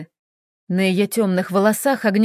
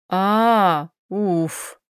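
dramatic sigh of relief; theatrical exhale; 3 semitones lower than usual. Acted. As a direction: puff out in mock relief. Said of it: "Он театрально выдохнул".

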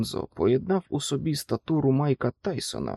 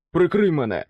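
loud, higher call; exclaimed order; 4 semitones higher, 6 dB louder than usual. A narrator's reading. Soldier calls to his teammate for cover, raised shout. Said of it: "вигукнув Алонзо".

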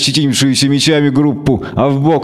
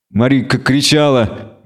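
ordinary delivery

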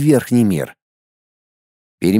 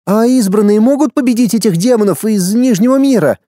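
put-on higher, eager boy's question; young boy voice; 7 semitones higher than usual. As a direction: ask like a curious boy. A boy asks the teacher.

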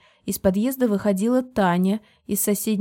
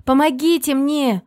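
slow, loud, high plea for help; crying for help; 7 semitones higher, 6 dB louder than usual. A narrator's reading. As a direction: call out for help, drawn out. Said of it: "закричала я".

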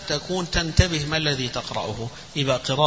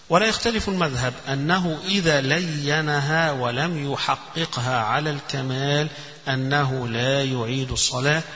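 ordinary talk, nothing marked

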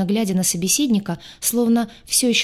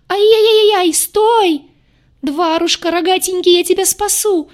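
high, loud frantic shriek; 12 semitones higher, 8 dB louder than usual. Acted. Crazed girl shrieks while chasing the demon. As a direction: squeal it like a madwoman. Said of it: "визжала полоумная девица".

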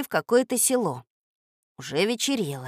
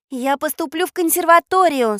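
put-on defiant girl; 10 semitones higher, 8 dB louder than usual. Катя упрямо возражает.

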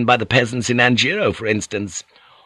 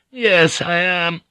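much higher coy self-agreement; smug; 8 semitones higher, slower than usual. Acted. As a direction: preen to herself. Gyoko's smug inner reply.

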